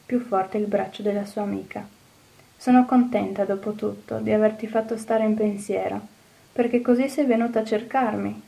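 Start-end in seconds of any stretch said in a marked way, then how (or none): none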